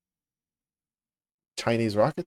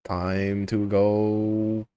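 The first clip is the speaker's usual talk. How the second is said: very slow, stretched-out words; slow drawl; much slower than usual. Acted. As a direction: draw it out ominously. Drawn out before killing.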